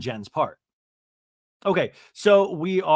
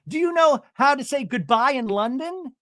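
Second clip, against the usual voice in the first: high, loud theatrical question; Shannon; 10 semitones higher, 5 dB louder than usual. Retold or imitated. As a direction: imitate an excitable mom quizzing her daughter. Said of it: "She goes".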